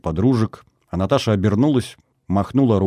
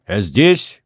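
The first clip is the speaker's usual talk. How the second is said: mild shout back; shouted reply; 4 dB louder than usual. A narrator's reading. Hero answers his unseen friend, lightly shouting. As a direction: call back a little louder. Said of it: "крикнул я в ответ".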